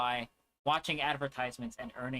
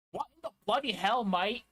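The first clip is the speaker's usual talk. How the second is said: higher-pitched, hammed-up Australian; jokey attempt at Irish that lands Australian; mock Australian accent; 6 semitones higher, 4 dB louder than usual. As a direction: put on a goofy Aussie accent. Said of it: "Actually, that's Australian".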